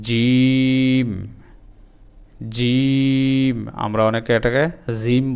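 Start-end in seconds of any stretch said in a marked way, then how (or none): 0.0-3.7 জিম stretched very slow and held; teacher drilling jim slowly; drawn-out jim. Shown as a demonstration. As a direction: hold জিম long like a teaching model. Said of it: "বর্গীয় জর মতো করে জিম".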